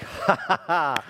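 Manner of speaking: breathy mock laughter, short bursts; God laughing. God chuckling at the pastor's question, laughing. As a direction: laugh it off like an amused father.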